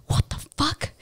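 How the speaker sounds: breathy whispered curse, voice dropped; whispered what the fuck. Retold or imitated. To his friend as the door pounds, a frightened whisper. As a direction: whisper it in alarm.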